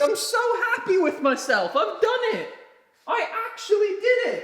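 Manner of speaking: high, laughing with pride; giddy laughing. Real, not acted. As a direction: laugh out the triumph. Proud of finished TV.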